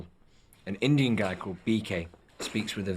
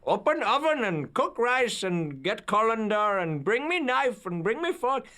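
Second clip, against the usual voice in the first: screaming orders; 14 semitones higher than usual. Retold or imitated. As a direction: yell kitchen orders in an Indian accent. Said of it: "like, screaming orders".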